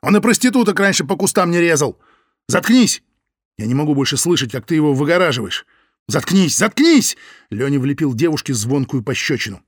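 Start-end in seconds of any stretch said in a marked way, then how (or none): 0.0-7.2 high furious yell; furious shouting. Acted. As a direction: scream at her to shut up. Лёня screams shut up.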